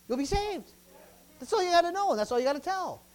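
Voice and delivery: excited pulpit emphasis; fired-up preaching. Real, not acted. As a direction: drive the good news home. Preaches the point home.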